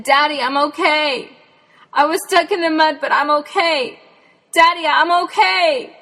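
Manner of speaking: high, loud call from far off; shouting back. Retold or imitated. Stuck Jen answering her searching dad, shouting high and loud. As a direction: yell so he can hear you.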